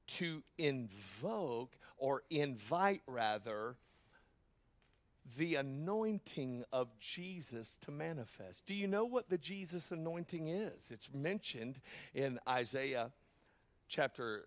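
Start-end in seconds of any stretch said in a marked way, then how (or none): none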